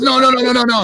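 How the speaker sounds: high, loud retold protest; excited insistence. Buddy cutting in on the phone, excitedly insistent. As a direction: rattle off the denial in a high voice.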